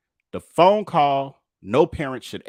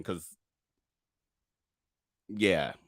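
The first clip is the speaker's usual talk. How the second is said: usual speaking voice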